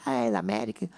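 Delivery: a husband's hammed-up fan cry; fan cheering. Husband cheering his football team on TV, mock excited. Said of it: "le va a la América y grita".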